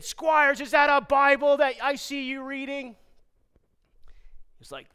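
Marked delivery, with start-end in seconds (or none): 0.0-3.0 high, sustained military shout; barking commanding officer. Retold or imitated. Commanding officer challenges trainee caught reading, loudly barking. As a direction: bellow the question on one raised pitch.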